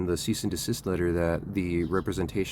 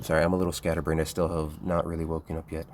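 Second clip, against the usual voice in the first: usual speaking voice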